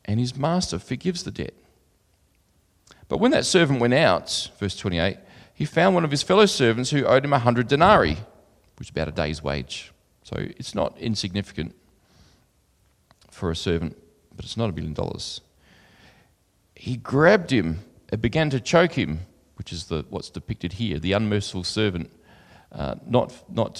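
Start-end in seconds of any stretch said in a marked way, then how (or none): 3.1-4.5 raised, carrying Bible reading voice; louder and higher than his own talk, like reading from the pulpit; scripture reading. Acted. Reads scripture aloud. 5.5-8.2 raised, carrying Bible reading voice; louder and higher than his own talk, like reading from the pulpit; scripture reading. Acted. Reads scripture aloud. 16.7-19.3 raised, carrying Bible reading voice; scripture reading. Acted. Reads scripture aloud.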